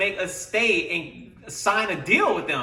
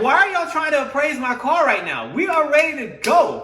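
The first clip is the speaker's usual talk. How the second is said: louder, higher fed-up outburst; angry demand; 8 semitones higher, 6 dB louder than usual. Retold or imitated. Customer demanding his car from the dealership, angry and loud. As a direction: snap at them to hand the car back.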